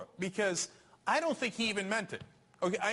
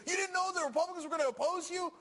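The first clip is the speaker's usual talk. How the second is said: incredulous; 10 semitones higher than usual. Real, not acted. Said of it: "that's crazy".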